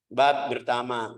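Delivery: ordinary talk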